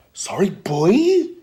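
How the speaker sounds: high, loud, drawn-out incredulous question; outraged echo. Acted. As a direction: throw it back, stretched and rising. Father throws apology back.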